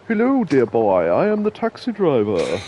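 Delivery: slow, plummy posh English; a hint of a posh old taxi driver. Recalling the taxi driver for chat in a posh accent. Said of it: "do the posh voice earlier for the old taxi driver".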